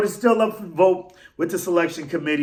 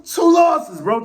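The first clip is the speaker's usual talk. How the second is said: sudden shout; 9 semitones higher, 6 dB louder, much slower than usual. Real, not acted. Ranting fan bursts out.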